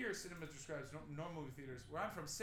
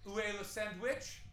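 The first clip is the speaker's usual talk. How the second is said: tourist French; 5 semitones higher, 3 dB louder than usual. Shown as a demonstration. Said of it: "Where is Sandwich".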